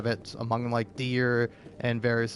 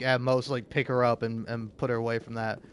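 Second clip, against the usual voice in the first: ordinary delivery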